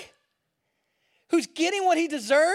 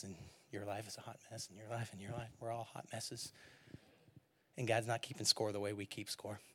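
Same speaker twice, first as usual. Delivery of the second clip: soft intimate preaching; 10 dB quieter than usual. Acted.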